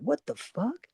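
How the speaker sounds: floored, breathing it out softly; stunned. Retold or imitated. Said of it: "I was like".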